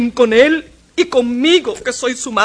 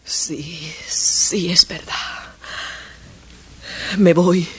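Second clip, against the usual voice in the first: barely voiced, softer; defeated whisper; 5 semitones lower, 6 dB quieter, much slower than usual. Mother giving up before leaving, whispered. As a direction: let the words die out in defeat.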